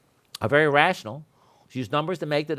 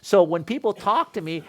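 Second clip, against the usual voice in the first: ordinary delivery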